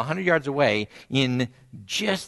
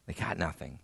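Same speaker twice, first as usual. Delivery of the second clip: soft echo; 7 semitones lower, 14 dB quieter than usual. Acted. Repeats phrase softly.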